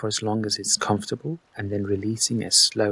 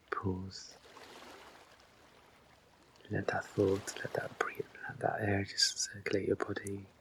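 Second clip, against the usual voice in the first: much softer, near-whispered instructions; hushed breathing guidance; 11 dB quieter than usual. Real, not acted. Guide counting listeners through held breaths, hushed and unhurried. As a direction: talk them softly through each breath, leaving long pauses.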